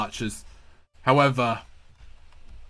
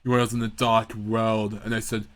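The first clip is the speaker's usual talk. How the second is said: ordinary delivery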